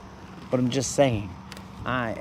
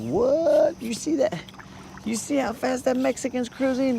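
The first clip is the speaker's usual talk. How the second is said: much higher, excited onlookers pointing him out; amazed spectators; 12 semitones higher than usual. Pretend. Crowd of spectators marveling at his speed, high and excited. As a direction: gasp it out like a stunned crowd at the race.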